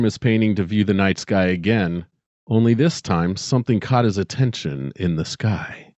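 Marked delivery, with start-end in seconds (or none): none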